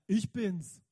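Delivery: loud, proud booming announcement; booming reveal. Acted. Jesus announcing himself to the scared disciples, booming. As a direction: boom it out like a big reveal.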